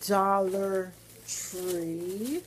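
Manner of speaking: Dollar Tree stretched very slow with a rise; drawn-out announce. Real, not acted. Vlogger announcing her next stop, stretched out. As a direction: sing out Dollar Tree slowly.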